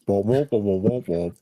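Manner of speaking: muffled trombone womp imitation; Peanuts teacher womp. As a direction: womp it like the Charlie Brown teacher. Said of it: "Sound like the teacher from Peanuts".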